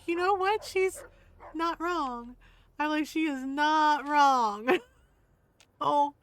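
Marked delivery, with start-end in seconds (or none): 0.1-2.4 high-pitched, laughter still in the voice; high, giggly agreement; amused concession. Retold or imitated. Amused agreement after cracking up. 3.1-4.8 "not" drawn out slowly; slow, weighty stress on "not wrong"; emphatic agreement. Retold or imitated.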